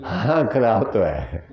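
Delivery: slow, drawn-out, dismissive imitation; mock offhand doctor. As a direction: stretch it out like a doctor brushing it off. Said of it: "तो doctor कहता है". (धीमी, लापरवाह doctor की नकल)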